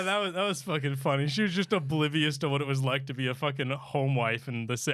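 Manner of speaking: normal talking voice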